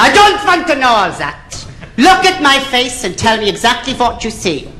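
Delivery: high, brusque interviewer voice; Nicky Lauder. Acted. Airline boss interrupting a pilot applicant, brusque. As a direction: cut in with a high, comic boss voice. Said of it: "And Nicky Lauder said".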